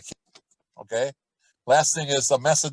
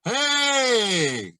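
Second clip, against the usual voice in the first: high ringing call from the back of the mouth; practice shout; 8 semitones higher than usual. Shown as a demonstration. Teacher demonstrating shouts to students, high back-placed call. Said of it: "a couple of shouts".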